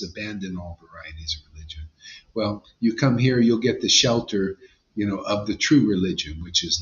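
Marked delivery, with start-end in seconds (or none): 0.0-1.8 low, soft unvoiced whisper. Preacher quoting Krishna's words in a hushed whisper. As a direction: breathe the quote as a secret. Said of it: "Why do you think Krishna says".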